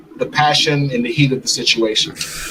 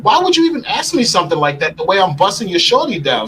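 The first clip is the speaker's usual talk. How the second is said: normal talking voice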